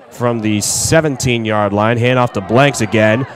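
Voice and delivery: normal talking voice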